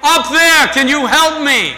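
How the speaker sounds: high, loud desperate call upward; man dangling from a branch shouting to the sky; crying out for help. A narrator's reading. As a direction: yell up from the cliff for help. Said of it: "he cries out".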